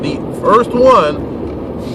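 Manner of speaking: "the first one" stretched out very slow, each word spaced; slow right down so nobody misses "the first one"; said slowly. Shown as a demonstration. Host repeating his point to retailers, very slowly. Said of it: "Let me say it again slowly".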